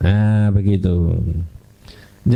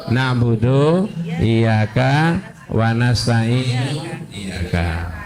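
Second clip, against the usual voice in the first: slow reordered reading; 2 semitones lower, much slower than usual. Shown as a demonstration. Teacher slowly showing the grammatical but forbidden order. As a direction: spell out the swapped order slowly, let the last word fade. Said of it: "Lah kalau iyyaka di belakang, bacanya gimana?".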